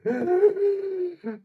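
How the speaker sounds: sharp high manic cackle. Acted. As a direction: burst into a mad cackle. Creature laughs.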